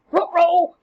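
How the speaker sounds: high, loud mock alarm; a caught villain's comic yelp; hammed-up guilty uncle; cartoon uh-oh.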